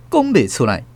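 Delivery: ordinary delivery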